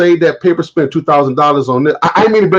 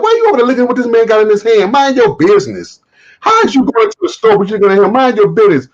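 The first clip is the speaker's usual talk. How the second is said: mock scolding; 7 semitones higher, faster than usual. Mocking nosy shoppers.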